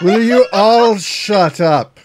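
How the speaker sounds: loud, slow, drawn-out demand; exasperated outburst. Acted. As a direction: raise your voice and stretch each word. Meditator snaps at noisy party.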